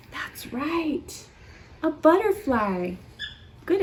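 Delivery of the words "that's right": hushed gasp. Acted. Praising a child's answer.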